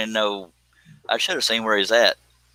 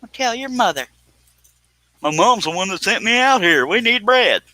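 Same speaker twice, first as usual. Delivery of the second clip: scolding neighbors; 10 semitones higher than usual. Retold or imitated. People telling the streamer off, mock scolding voice. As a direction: nag him like a fed-up neighbour. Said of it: "People just say".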